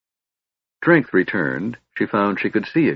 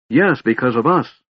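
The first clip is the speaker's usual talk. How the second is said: mild angry retort, pitched higher; angry shout; 5 semitones higher, 4 dB louder than usual. A narrator's reading. Laurana answers her brother back, mild angry shout. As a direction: snap back, raised but short of a full shout. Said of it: "Laurana shouted angrily".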